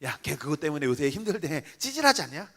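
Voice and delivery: hushed sneering gossip, pitch jumping up at the end; gossiping behind back. Acted. Friend gossiping about someone's troubles, belittling. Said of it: "돌아다니면서 내 욕하고 다녀요".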